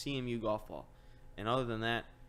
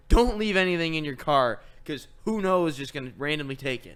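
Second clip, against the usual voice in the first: loud, raised punchline delivery; emphatic warning; 4 semitones higher, 10 dB louder than usual. Real, not acted. Storyteller giving friend the moral of the story, emphatic. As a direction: hammer the lesson home loudly.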